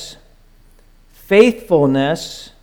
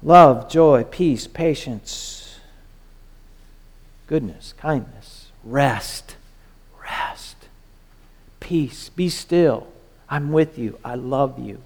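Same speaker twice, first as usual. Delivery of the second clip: much softer, slow, fading almost to a whisper; Spirit's tender voice; 4 semitones lower, 6 dB quieter than usual. Acted. The Spirit comforting the believer, soft and tender. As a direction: soothe them like a gentle voice within. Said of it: "It's the Spirit going".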